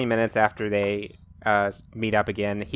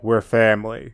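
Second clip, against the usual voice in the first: Vin Diesel voice; 5 dB louder than usual. Retold or imitated. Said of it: "He's like".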